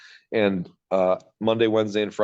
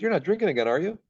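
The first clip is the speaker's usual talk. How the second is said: higher, lightly voiced concerned friend; worried friend; 10 semitones higher than usual. Friend Mark on the phone hearing him drunk, higher and concerned. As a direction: sound gently worried for him, pitched up.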